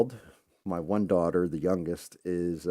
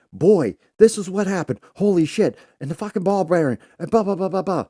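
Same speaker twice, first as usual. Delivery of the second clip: frantic high loud babble; hammed-up panic, pitched way up and loud; panicked rant; 11 semitones higher, 8 dB louder than usual. Telling son about the crash, high frantic panic. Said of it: "And I'm going off".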